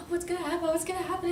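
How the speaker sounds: gasp, then high worried questions; play-acted fear. Pigs hide under covers, high anxious fretting. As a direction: fret in a small high voice.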